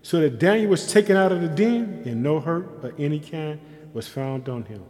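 loud, drawn-out declamation with pauses; preached scripture reading. Real, not acted. Preacher reading of Daniel's rescue, slow loud preaching. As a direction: proclaim the rescue slowly, weighting each phrase. Reads verse at preaching force.

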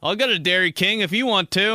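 lightly voiced dad arguing about ice cream; imitated dad. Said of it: "that's some my dad shit right there".

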